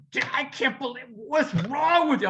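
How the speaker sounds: high, exasperated imitation of his wife; mock wife scolding. Wife retold berating him in mock dismay. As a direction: play the shocked wife, half-joking. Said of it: "later she goes".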